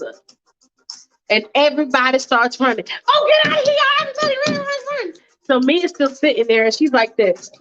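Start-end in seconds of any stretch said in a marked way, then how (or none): 3.1-5.1 1 panicked high yelling. Acted. Someone yelling as a car arrives.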